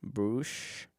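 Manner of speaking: ordinary talk, nothing marked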